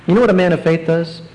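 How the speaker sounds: normal talking voice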